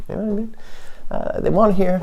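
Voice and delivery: ordinary talk, nothing marked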